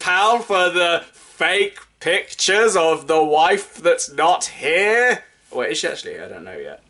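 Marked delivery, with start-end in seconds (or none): 0.0-5.2 loud, high, slow mock thanks; gloating villain voice, slow and loud; hammed-up conspirator. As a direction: drag it out like a smug cartoon spy. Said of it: "I was hamming it up for comedic effect".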